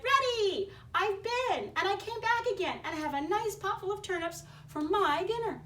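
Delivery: pig voice. Acted. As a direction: crow it back at the wolf. Pig boasting to the wolf.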